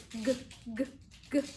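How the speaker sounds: short hard "g" sounds; isolated letter sound. Shown as a demonstration. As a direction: say "g" as in gato, alone and brief. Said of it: "los sonidos".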